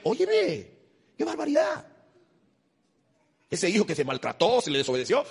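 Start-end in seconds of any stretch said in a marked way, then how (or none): 0.0-1.8 high, indignant exclamation; exasperated. Real, not acted. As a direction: throw up your hands in disbelief. Mocking believers' hypocrisy.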